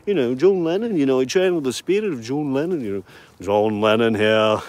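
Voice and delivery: a hint of sixties hippie, lazy and loose. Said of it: "who else do we need to impersonate".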